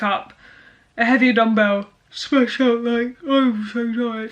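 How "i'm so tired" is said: slow, drawn-out fake whine of fatigue; a hammed-up worn-out gym-goer; mock tired moan. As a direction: drag the words like you're spent. Mock gym complaint mid-set.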